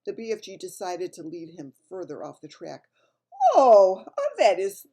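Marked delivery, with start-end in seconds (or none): 3.5-4.9 high, eager BFG voice; friendly giant. A narrator's reading. BFG praises the snozcumber to mislead Bloodbottler, high and eager. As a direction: sell the vegetable with bright enthusiasm. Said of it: "he said".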